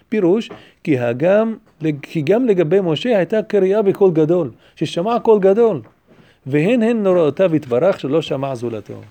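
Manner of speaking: loud, rapid recitation of a text; reading Hebrew commentary aloud. A narrator's reading. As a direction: read the Hebrew out briskly and emphatically.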